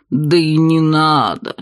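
slow low alien voice; gurgling tentacle alien. Acted. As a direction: stretch it out, otherworldly. Octopus bartender speaks.

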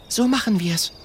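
put-on high girlish voice. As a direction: agree decisively. Mathilda voiced.

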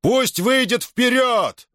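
high, slow, ringing command; booming shout. Acted. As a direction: bellow it across the field. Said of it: "прогремело над полем".

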